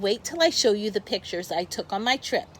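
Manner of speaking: usual speaking voice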